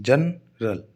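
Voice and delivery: "जनरल" broken as जन, pause, रल; split into syllables. Shown as a demonstration. Teacher showing students two sounds in one word, "जनरल" split in two. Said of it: "देखो दो sound है इसमें".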